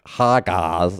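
drawn-out mock girl-speak greeting; imitation of girls saying hello; mimicking girls. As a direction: stretch the greeting like affected girl talk. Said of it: "every girl was like".

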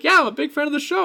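high, fast gushing fan voice; eager fan girl. Retold or imitated. Fan girl tells him she loves the show, high and quick. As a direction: gush like an excited fan.

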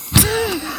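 short high burst of laughter. Real, not acted. Laughs at the footballer quote.

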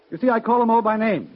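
proud voice. Acted. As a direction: announce it like the master of creation. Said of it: "The proud voice?".

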